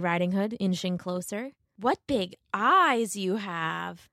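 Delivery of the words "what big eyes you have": put-on slow, lightly girlish wonder; wondering Little Red. A narrator's reading. Little Red studying grandmother, slow mild wonder. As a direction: linger with a hint of puzzlement.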